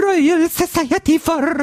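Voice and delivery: very high, slow strained Scottish squeal; a high, drawn-out overdone Scottish accent; screeching Scottish presenter voice; panicked Scottish accent. Retold or imitated. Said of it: "I panicked".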